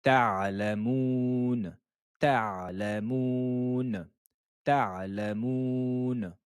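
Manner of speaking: ta'lamoun very slowly, syllable by syllable with the Ain. Shown as a demonstration. Teacher drilling students on ta'lamoun, slow and careful. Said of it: "sur la lettre Ain".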